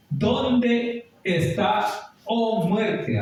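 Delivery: high, very drawn-out dramatic question; rhetorical question. Acted. Preacher challenging death with a long, high rhetorical question. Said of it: "está haciendo una pregunta el apóstol Pablo".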